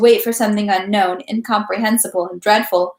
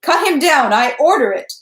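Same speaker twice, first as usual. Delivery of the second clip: high, raised command; wrathful shout; 5 semitones higher, 4 dB louder than usual. A narrator's reading. Count ordering the crowd to kill, wrathful raised voice. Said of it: "the wrathful sound of Rostopchin's voice".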